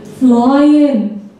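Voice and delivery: "Flying" drawn out in northern English; broad northern English "Flying" for repeating; northern English accent. Shown as a demonstration. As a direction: model "Flying" in a Durham accent. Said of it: "Say it".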